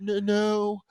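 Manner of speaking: put-on high, faltering girl's voice; caught-out faker. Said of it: "the girl's like".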